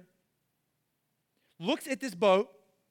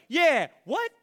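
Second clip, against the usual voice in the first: high, loud, incredulous yelp; can't believe what he just said; disciples baffled; 8 semitones higher, 8 dB louder than usual. Acted. Disciples reacting to Peter, shocked and incredulous. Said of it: "the other disciples were like".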